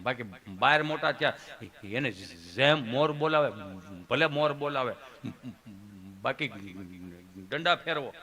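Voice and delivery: low, halting friend; Gabho. Acted. Friend airing his complaint to the comedian, low and halting. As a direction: grumble low, pausing after each phrase. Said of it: "ઈ કે".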